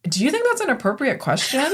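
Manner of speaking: high, pointed teacher rebuke; stern rebuke. Retold or imitated. Teacher to student, pointed stern rebuke. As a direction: scold the kid for a rude question. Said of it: "I go".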